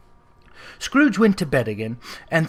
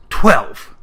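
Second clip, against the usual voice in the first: sudden loud disbelieving cry; a sharp astonished Twelve; startled exclamation; 3 semitones lower, 7 dB louder than usual. A narrator's reading. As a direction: blurt it out in shock. Scrooge exclaims at wrong clock.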